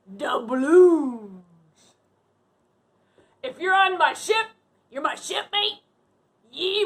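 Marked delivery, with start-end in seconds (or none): 0.1-1.4 "Doubloons" said lower in a lightly voiced pirate tone; pirate word. Shown as a demonstration. Captain teaching kids pirate words, mild pirate voice. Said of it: "and we say".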